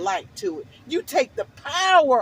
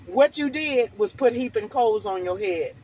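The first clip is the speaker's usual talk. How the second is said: ordinary delivery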